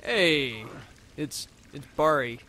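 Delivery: ordinary talk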